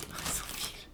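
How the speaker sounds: giggling through the words; laughing. Real, not acted. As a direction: laugh it out softly. Laughing at the pen mix-up.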